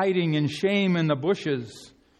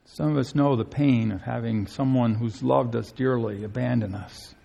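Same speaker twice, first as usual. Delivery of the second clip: ordinary delivery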